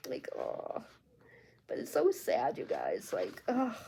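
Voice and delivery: quiet, breath-heavy, moved by sadness; saddened. Real, not acted. Reader telling viewers how sad the book is, saddened. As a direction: sigh it out softly, genuinely saddened.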